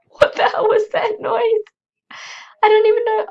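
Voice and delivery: high, loud panicked cry; startled out of her wits, yelling; frightened shriek. Real, not acted. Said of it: "that was just my fear kicking in".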